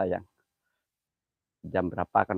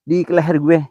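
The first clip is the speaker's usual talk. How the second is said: alarmed; 5 semitones higher, 9 dB louder than usual. Real, not acted. Feels something on his neck.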